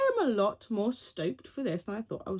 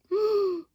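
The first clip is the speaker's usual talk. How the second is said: dramatic gasp; 11 semitones higher than usual. Real, not acted. Shocked by imagined scene.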